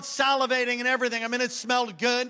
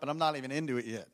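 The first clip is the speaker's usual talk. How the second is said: normal talking voice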